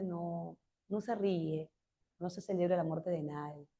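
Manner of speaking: mildly voiced conscience saying no; soft reproach. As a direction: hush them like a calm moral voice.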